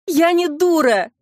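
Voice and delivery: high, drawn-out wail, lightly acted; sobbing. A narrator's reading. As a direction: wail it in narrated, restrained sobs. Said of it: "рыдала Клара". (плачущим голосом)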